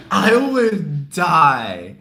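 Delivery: usual speaking voice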